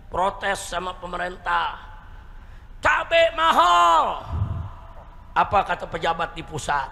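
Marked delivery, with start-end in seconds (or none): 2.8-4.2 imitating a crowd's high, drawn-out yell; protesting shout. Mothers protesting to the government about chilli prices, shouting. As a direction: cry it out loud, stretching the words. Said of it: "Ibu-ibu teriak".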